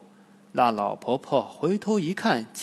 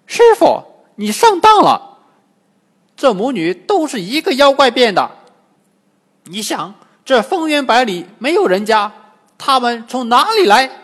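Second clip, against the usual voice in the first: much higher and louder, an urgent warning; excited monkey voice, pitched way up; urgent Monkey King; 10 semitones higher, 13 dB louder than usual. Acted. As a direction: cry out the truth to your master. Wukong urgently warns master.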